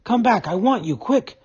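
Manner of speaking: high, loud eager shouts; urgent, thrilled calling after a friend; joyful excitement. A narrator's reading. Mole calls Rat back, high and excited. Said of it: "full of joyful excitement".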